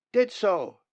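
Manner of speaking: high, loud insisting chick voice; bratty nestling. Acted. Nestlings squabbling in nest.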